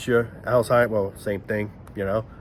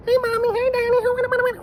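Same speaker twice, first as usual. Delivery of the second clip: put-on very high little-kid voice; squeaky kid voice. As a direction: squeak it like a small child. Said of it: "that squeaker voice".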